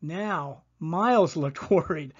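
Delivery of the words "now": ordinary delivery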